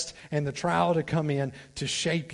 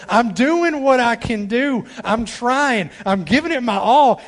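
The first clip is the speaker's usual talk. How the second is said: louder and higher, slow on "I'm doing"; voicing a frustrated believer; 7 semitones higher, 9 dB louder than usual. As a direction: cry out your effort to God. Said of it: "you look at God sometimes and you go".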